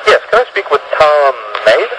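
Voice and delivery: ordinary delivery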